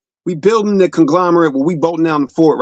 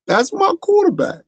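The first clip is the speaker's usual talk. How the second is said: much higher, emotional cry of praise; Terrell Owens impression. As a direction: gush it like a star receiver close to tears. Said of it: "In my Terrell Owen voice".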